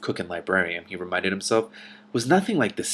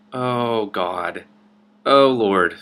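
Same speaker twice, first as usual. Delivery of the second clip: louder, falling oh Lord; loud exasperated groan; 6 dB louder, much slower than usual. Real, not acted. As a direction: let "Oh, Lord" drop like a real sigh of exasperation. Reader reacts before reading line.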